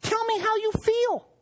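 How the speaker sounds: a husband playfully voicing his wife's request; imitating his wife. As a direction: mimic her gently in a high voice. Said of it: "she would say".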